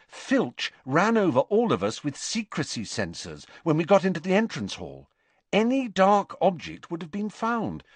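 high, brisk explaining voice; Hermione. A narrator's reading. Narrator voices Hermione in dialogue.

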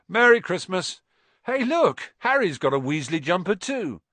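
loud, high, excited calling out; boisterous greeting. A narrator's reading. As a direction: burst in and shout it cheerfully. Twins burst boisterously into dormitory.

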